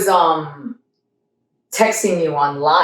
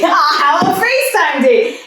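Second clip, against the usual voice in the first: laughing; 9 semitones higher, 3 dB louder than usual. Retold or imitated. Retelling accepting a FaceTime date.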